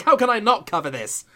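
ordinary talk, nothing marked

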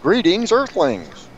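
high sci-fi alien greeting. Pretend. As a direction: hail the humans like a spaceman alien. Radio guest greets listeners as alien.

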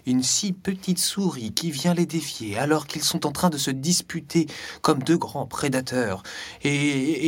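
ordinary delivery, nothing marked

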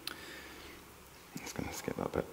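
very quiet, slow whisper; whispered aside. Real, not acted. Preacher to self about skipping a bit, whispered aside. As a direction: whisper it under your breath, slowly.

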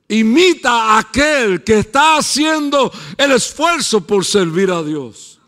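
loud, high, driving sermon voice; fiery preaching. Acted. Pastor preaching to the congregation, building and loud.

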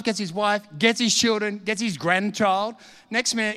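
ordinary delivery

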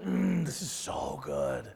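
very low, slow relish; blissful savoring. Acted. Preacher acting out savoring the word, slow blissful relish. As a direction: draw it out softly with a deep, contented hum.

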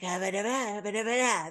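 nonsense syllables mimicking a narrator; Meredith Grey. Podcaster mimicking how the show's narrator talks, wordless imitation. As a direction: lightly imitate a voiceover's cadence in babble. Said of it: "That's how she talks".

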